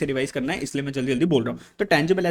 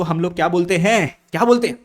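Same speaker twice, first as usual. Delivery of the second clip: loud prompt; 3 semitones higher, 6 dB louder than usual. Real, not acted. Teacher pressing class for answer.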